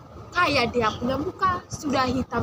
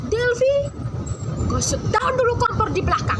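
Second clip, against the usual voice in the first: much higher and louder shout; mama yelling; 10 semitones higher, 3 dB louder than usual. Retold or imitated. Mama shouts from the kitchen to her daughter, yelling. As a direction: holler the order from the kitchen. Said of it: "Mama teriak dari dapur".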